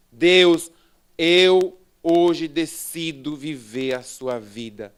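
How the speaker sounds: slow, emphatic vow to God; loud opening, deliberate and resolute; solemn declaration. Retold or imitated. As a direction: declare it forcefully, word by word.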